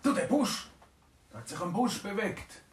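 a dragon calling out that the bush moved; excited dragon. Acted. Dragon spots moving bush. (leicht aufgeregt, höher)

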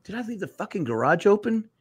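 high-pitched retold worry; alarmed self-question. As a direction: ask it high, as if suddenly worried. Retelling own worried self-questioning.

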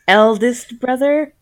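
mock-formal address. Joking about what to call many brothers, mock-formal. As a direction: spell out each title with laboured care.

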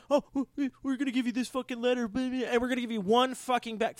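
lightly voiced, sneering motor vehicle office; mocking officials. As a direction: parody the agency's letter. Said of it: "Oh, yeah".

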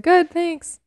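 high, tight, overly polite answer; curt polite reply. Retold or imitated. Sister answering Fleabag, high and tightly polite. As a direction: hide the fury behind a bright reply. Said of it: "And her sister's like".

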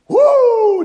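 very high, loud celebratory whoop; joyful whoop. Acted. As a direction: whoop with delight. God rejoicing over a penitent.

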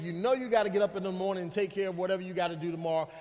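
normal talking voice

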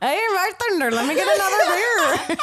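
amused Irish attempt sounding Indian; Irish gone Indian. Shown as a demonstration. Guest ordering another beer, botched Irish accent. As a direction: try Irish, slipping into Indian. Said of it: "every time Indian".

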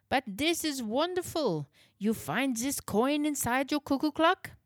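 put-on high, amazed German accent. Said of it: "His voice held a touch of awe".

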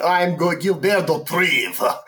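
hammed-up Russian; Russian accent. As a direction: announce the name in a thick Russian accent. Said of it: "with a Russian accent".

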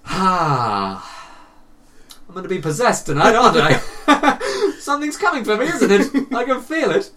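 high, loud wail breaking into laughter; mock panic. Retelling his night in bed after the film, mock panic.

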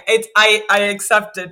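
usual speaking voice

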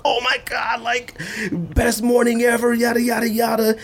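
high mock-thrilled fan voice; excited gushing. Imitating Twitter users celebrating, pitched high. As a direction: squeal it like the best morning ever.

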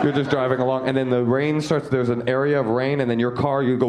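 ordinary talk

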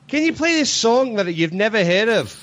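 quoting the pushy Aussie guest; Australian guest. Retold or imitated. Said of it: "somebody from the guy's side".

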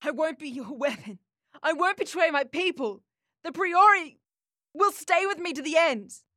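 high, fierce, defiant voice; defiant girl. A narrator's reading. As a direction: spit the words at him. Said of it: "I spat at him with venom".

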